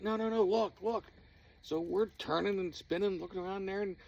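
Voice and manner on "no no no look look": high, loud urgent no no no; urgent insisting. Retold or imitated. Friend urging the group to look, high loud insisting. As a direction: insist hard, pointing. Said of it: "And he's like".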